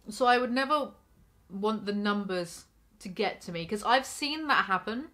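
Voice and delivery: ordinary talk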